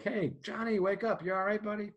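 high-pitched wake-up holler; yelling to rouse. Shown as a demonstration. Medic yelling at an unresponsive guy to wake him. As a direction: call out sharply to get a response. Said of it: "we yell at this guy".

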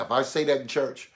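ordinary talk, nothing marked

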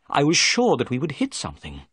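ordinary delivery

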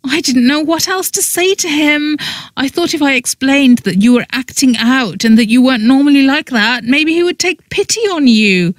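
high-pitched plaintive excuse; pleading. A narrator's reading. Friend explaining lie to mortified woman, pleading. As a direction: plead your case in a wail.

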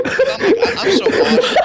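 laughing while talking. Real, not acted. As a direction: giggle through it. Laughing about the bad round.

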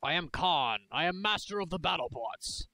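lightly voiced boastful warlord; barbarian. Boasting battle-bot warrior persona.

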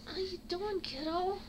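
high cooing to a kitten. Real, not acted.

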